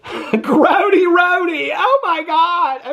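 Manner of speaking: high, slow, laughing shriek; laughing squeal. Real, not acted. Recapper cracking herself up with a squealed nickname. Laughing at her own joke.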